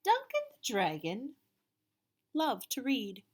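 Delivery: put-on picture book read to children; storybook narration. A narrator's reading. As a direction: tell it to the kids with big energy. Reads the picture book aloud.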